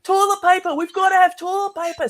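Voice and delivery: high, loud mock panic over toilet paper; panicked hoarder. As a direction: shriek it like a frantic shopper. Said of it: "it's like".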